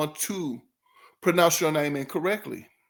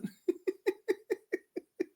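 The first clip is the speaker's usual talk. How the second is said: breathless laugh; 14 semitones higher, 8 dB quieter than usual. Real, not acted. Silent laugh.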